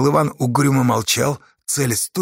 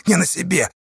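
high, strained croak; 7 semitones higher than usual. A narrator's reading. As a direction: croak it out urgently. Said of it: "прохрипел он".

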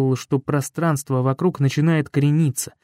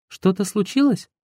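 higher, worried woman's voice; Yulia's voice; 6 semitones higher than usual. Acted. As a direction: ask higher, anxious.

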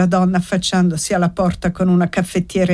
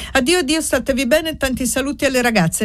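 put-on higher, quick young woman's farewell; hurried goodbye; 7 semitones higher, faster than usual. A narrator's reading. Annessa hurries away from zia Anna, higher and quick. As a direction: rush the goodbyes, eager to leave.

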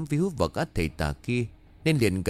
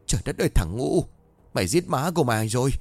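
angry outburst; 5 semitones higher than usual. A narrator's reading. Father scolding his son for digging up the charm, angry. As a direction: yell at the foolish son in anger. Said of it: "Ông Tư Thắng bấy giờ tức giận".

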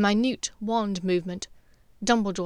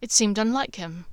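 plain reading voice

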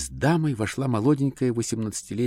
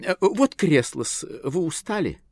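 flustered artist; 10 semitones higher, 4 dB louder than usual. A narrator's reading. Artist offers a chair.

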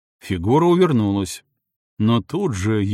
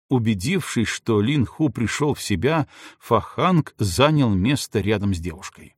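plain reading voice

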